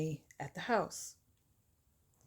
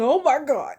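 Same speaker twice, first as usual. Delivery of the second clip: loud, higher-pitched cry of horror; horrified; 7 semitones higher, 9 dB louder than usual. Retold or imitated. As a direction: exclaim as if sickened. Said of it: "about to throw up".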